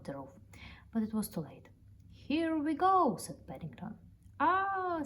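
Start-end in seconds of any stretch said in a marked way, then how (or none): 2.1-3.2 much higher, slow and louder; thrilled. Acted. Bear on a sliding sleigh, thrilled and slow. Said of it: "sleigh sliding off roof". 4.4-5.0 scared cry. Acted. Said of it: "shut his eyes and waited for the crash".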